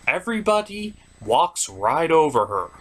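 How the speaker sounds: ordinary talk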